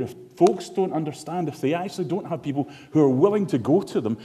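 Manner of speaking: rising, forceful sermon delivery; full-voiced preacher building to a climax; preaching. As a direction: drive the conviction home to the church. Preaching to the congregation.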